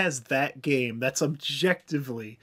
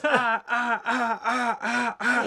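high repeated mechanical ahs; dispenser sound imitation; 7 semitones higher, slower than usual. Mimicking a TF2 dispenser for his friend, high mechanical ahs. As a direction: buzz out the ahs like a game machine humming. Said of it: "The sound of a dispenser".

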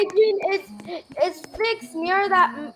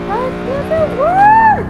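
drawn-out frustrated complaint; slow, high, exasperated shout; frustrated yell; 8 semitones higher, 4 dB louder, much slower than usual. Real, not acted. Frustrated at broken game.

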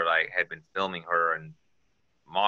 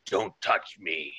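slow, drawn-out mock angry warning; acted-out hostile warning, not shouted; retold anger; much slower than usual. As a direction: imitate her angry refusal, stretching each word. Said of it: "she turns around angry".

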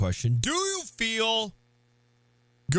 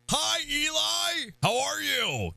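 excited greeting; 8 semitones higher, slower than usual. Real, not acted. Greeting a chat viewer.

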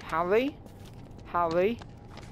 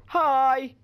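mock cheery hi; 10 semitones higher, 6 dB louder than usual.

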